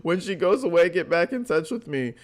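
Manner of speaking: scoffing singsong repeat of her text; mocking emphasis. Shown as a demonstration. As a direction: throw the line back at her, sarcastic. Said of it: "laughs then repeats".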